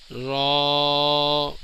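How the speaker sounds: را with a long rounded vowel; drawn-out letter. Shown as a demonstration. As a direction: hold را on one level note. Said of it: "کھینچ کے پڑھیں گے".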